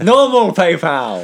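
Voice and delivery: exaggerated Scottish imitation; mock Scottish accent. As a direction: mimic the Scottish vowels, drawn out. Mimics Scottish "normal people.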